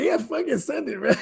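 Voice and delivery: high excited bro voice; frat bro. Retold or imitated. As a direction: hype it up like a stoked bro.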